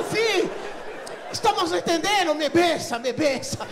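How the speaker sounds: high, loud hammed-up argument; discussão exaltada. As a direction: keep shouting in comic exasperation, easing at the end. Discussão gritada continua no balcão. (bate-boca gritado, agudo e encenado)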